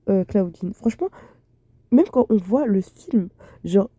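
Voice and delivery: ordinary talk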